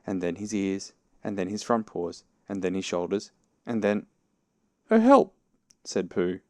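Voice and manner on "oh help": high, loud cry; calling for help. A narrator's reading. Pooh stuck in the hole, calling for help.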